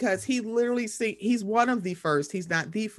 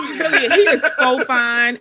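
louder gushing through laughter; laughing gush; 5 semitones higher, 9 dB louder than usual. Real, not acted. Swooning over Henry Cavill, laughing.

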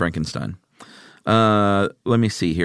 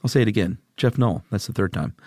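ordinary talk

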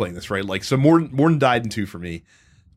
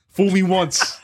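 laughing retort; 5 semitones higher, slower than usual. Retold or imitated.

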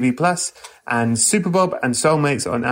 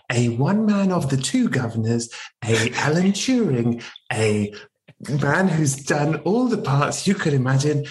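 grand announcer build to a high finish; showman introduction; 4 semitones higher than usual. Acted. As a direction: hype up the guest like a ringmaster. Hammed-up grand guest introduction.

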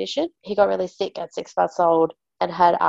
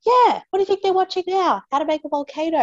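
much higher, fast and sarcastic; sarcastic exasperation; 12 semitones higher, 3 dB louder, faster than usual. Retold or imitated. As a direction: snap it back, dripping with sarcasm. Exasperated retort to neighbour.

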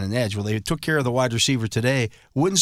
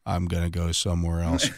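flat, lower monotone Belichick; 7 semitones lower than usual. Retold or imitated. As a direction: say it dead flat, no emotion. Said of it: "in a really monotone voice".

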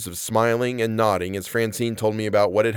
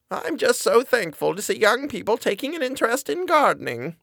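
high, sweet granny voice, musing; a high, fond old woman's musing; musing old lady; 12 semitones higher than usual. Acted. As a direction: muse fondly on young gardeners. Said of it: "she mused".

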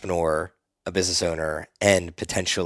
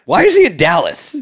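high, loud incredulous question; amused disbelief; 10 semitones higher, 7 dB louder than usual. Real, not acted. Host breaking into the guest's story, loud and incredulous. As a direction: blurt it out in real astonishment.

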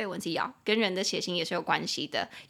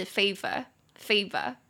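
slow British "favor" with an r-less ending; British accent; much slower than usual. Shown as a demonstration. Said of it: "它在英式里面我念是". (英式发音)

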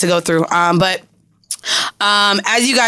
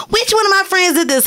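excited question; 11 semitones higher than usual. Retold or imitated. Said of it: "went on live and was like".

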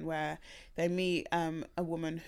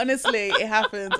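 laughing; 4 semitones higher, 11 dB louder than usual. Real, not acted. Laughing reassurance.

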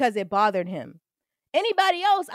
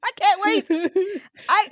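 higher and louder, bursting with glee; excited; 8 semitones higher, 4 dB louder, slower than usual. Real, not acted. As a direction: squeal it out with excitement. Eager anticipation of the show.

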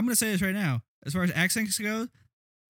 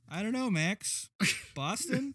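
higher, softer mock-weary answer; exasperated friend, imitated; 6 semitones higher, 6 dB quieter, slower than usual. Imitating John's reply to Max, weary and soft. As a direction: play a pal with head in hands. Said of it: "John head and hands goes".

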